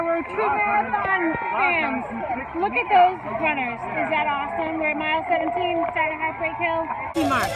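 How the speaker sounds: ordinary talk, nothing marked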